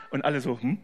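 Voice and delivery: normal talking voice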